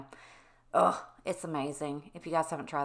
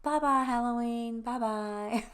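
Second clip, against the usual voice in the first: put-on higher, louder little-girl bye-bye; toddler voice; 6 semitones higher, 4 dB louder, slower than usual. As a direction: wave goodbye like a sweet two-year-old. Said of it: "she goes".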